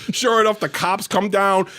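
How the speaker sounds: ordinary delivery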